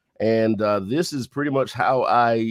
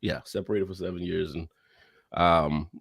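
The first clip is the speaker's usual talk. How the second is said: normal talking voice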